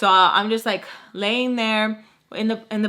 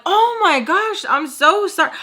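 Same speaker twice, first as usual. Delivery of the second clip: flustered apology; 9 semitones higher, 7 dB louder than usual. Retold or imitated. Startled guest apologising to the scolding host, flustered. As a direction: blurt it out, hammed-up and high.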